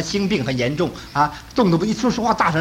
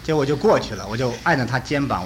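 usual speaking voice

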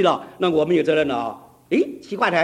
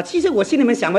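normal talking voice

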